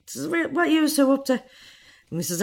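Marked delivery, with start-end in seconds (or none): 0.3-1.3 high, nosy questioning voice; questioning man. Retold or imitated. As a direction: voice the man quizzing them.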